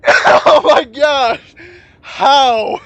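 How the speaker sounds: slow, loud, stunned cheer; can't believe it happened; excited disbelief. Real, not acted. Disbelief after lucky win.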